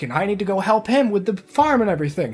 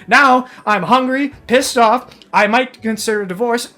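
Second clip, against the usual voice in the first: higher and louder, an escalating angry tirade; furious rant; 7 semitones higher, 6 dB louder than usual. Acted. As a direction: rant like a man at the end of his rope. Ranting as the angry neighbor.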